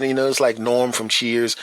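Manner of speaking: usual speaking voice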